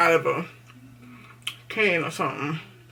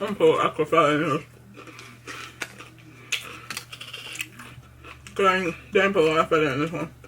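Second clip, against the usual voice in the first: mouth full; faster than usual. Real, not acted. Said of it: "I'm sorry if you suck on my mouth full".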